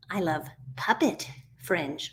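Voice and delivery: "Puppet" picked out with pauses, slow and soft overall; soft, low answer leaning on "Puppet"; stress on PUPPET. Acted. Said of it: "what kind of fringe festival".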